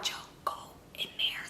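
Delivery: urgent hushed whisper. Retold or imitated. Telling Joe to go get them out, whispered. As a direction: whisper it so the others don't hear.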